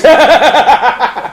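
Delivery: high staccato mad laugh; wicked Punch laugh; puppet cackle. Acted. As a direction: cackle in a put-on voice.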